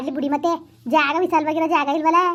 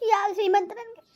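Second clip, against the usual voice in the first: higher-pitched, tearful question; 6 semitones higher than usual. Acted. Woman upset at talk of her seemanta ceremony, tearful. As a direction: start breaking into tears. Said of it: "ಅಳ್ತಾ ಕುಂತಿದ್ಯಲ್ಲ".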